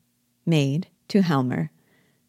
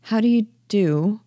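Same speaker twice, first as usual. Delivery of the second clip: higher, questioning greeting with a rising end; hesitant, puzzled lift on the greeting; doubtful; 5 semitones higher than usual. Acted. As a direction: greet someone you can't quite place. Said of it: "Nora, doubtfully".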